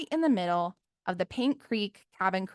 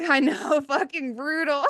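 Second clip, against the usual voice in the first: laughing; 6 semitones higher, 4 dB louder than usual. Real, not acted. Laughing at the story's brutality.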